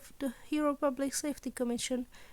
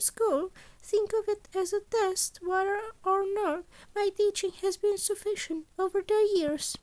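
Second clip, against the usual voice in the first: higher, brighter little principal voice; a scheming principal, a touch higher and louder; Nezu's voice; 8 semitones higher, 4 dB louder than usual. A narrator's reading. Principal Nezu explaining his deal, higher and louder.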